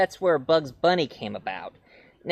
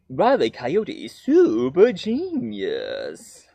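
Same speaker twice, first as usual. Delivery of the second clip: slow, smug self-introduction; lightly voiced smug coyote; Wile E. Coyote; much slower than usual. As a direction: announce yourself slowly, full of pride.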